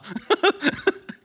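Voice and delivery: laughter climbing high; high rising laugh. Real, not acted. Pastor amused by the Bible passage, high rising laugh. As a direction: let a giggle rise up high.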